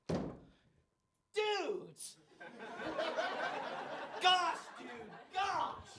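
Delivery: very high, squeaky attempt at anger; badly failed rage in a suburban US accent; mock suburban American anger. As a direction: powerless little high-pitched outburst.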